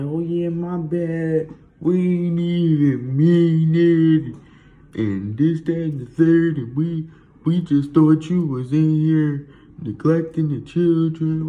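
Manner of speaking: slow, drawn-out high mock apology; mock an officer with long stretched words; sing-song drawl, high and loud; apologizing cops. Cops' apology acted out in put-on voice.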